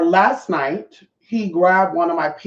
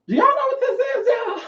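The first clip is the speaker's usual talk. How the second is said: very high, giggly squeak, laughing through it; squeaky teasing question. Shown as a demonstration. Teasing her viewers about a bottle, very high giggling squeak. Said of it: "I almost forgot what this was".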